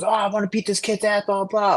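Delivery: lightly mock the angry kid; imitating Igor. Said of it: "And he goes".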